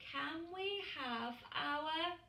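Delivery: slow, high pleading chick voice; child asking again on a long trip; whiny little penguin. A narrator's reading. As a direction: drag out the same old question. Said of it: "his usual question".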